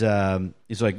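ordinary delivery

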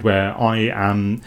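usual speaking voice

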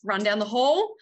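usual speaking voice

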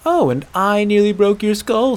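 high, loud comeback; indignant retort. Acted. Aela disputing the hunter's claim, indignant. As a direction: throw the accusation back. Acted quarrel, throwing accusation back.

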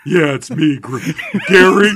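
loud big dumb guy voice; big brute. Pretend. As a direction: lumber in as a dim monster. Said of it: "Who's this, Grimace?".

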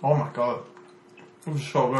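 talking while chewing; words round a bite of food; mouth full. Real, not acted. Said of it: "are you eating the duck right now".

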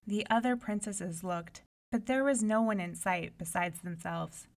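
usual speaking voice

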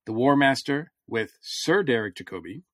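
ordinary talk

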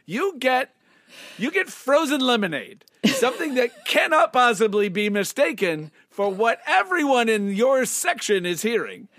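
loud, high scolding manager; mock stern boss. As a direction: lecture the vendor with mock outrage. Mock boss lecturing vendor.

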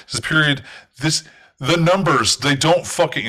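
starting to lose her temper; losing it. Acted. The woman losing it over the missing numbers, voice climbing. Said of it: "she starts to, like, lose her shit".

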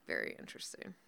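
low whispered aside. Real, not acted. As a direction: whisper it knowingly. Repeated in a whisper.

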